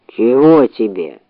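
low, slow sleepy bear voice. A narrator's reading. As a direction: mumble it half asleep, low. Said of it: "спросил он сонным голосом".

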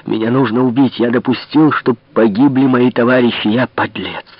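ordinary delivery, nothing marked

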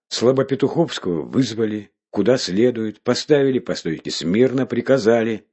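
usual speaking voice

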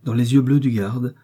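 ordinary delivery

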